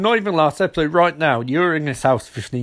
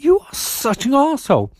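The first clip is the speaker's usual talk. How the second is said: mock indignation; 10 semitones higher than usual. Retold insult during acted argument.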